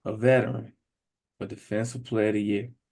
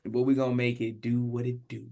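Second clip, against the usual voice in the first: ordinary talk, nothing marked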